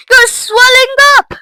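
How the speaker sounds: high, loud panicked shriek; repeated scream. A narrator's reading. Mother watching Violet keep swelling, screaming. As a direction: scream it in horror. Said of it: "screamed Missus Beauregard again".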